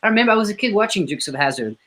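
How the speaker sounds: high, fast eager agreement; mock eager friend; excited. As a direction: gush like a fan. [name] replies excitedly.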